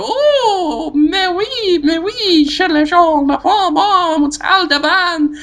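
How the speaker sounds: very high, laughing fake French moans; hammed-up French swooning, squealing; mock French ecstasy. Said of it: "and I go".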